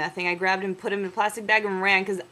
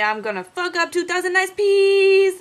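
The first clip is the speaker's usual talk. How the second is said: slow, louder, higher drawn-out sign-off; 9 semitones higher, 7 dB louder, slower than usual. Acted. Ending his year-end blog post, grand slow sign-off. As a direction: sing out the farewell.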